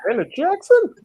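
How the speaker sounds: high, loud joking question; surprised high question. Real, not acted. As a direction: blurt it out surprised.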